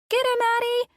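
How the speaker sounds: put-on very high, loud little boy's scream; screaming child. John screaming for Addie's help. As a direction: yell it with playful panic. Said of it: "John screamed".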